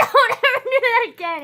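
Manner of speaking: high, loud, scandalized yell; outraged shout. Retold or imitated. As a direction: shriek it like your friend just crossed a line. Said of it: "and I was just like".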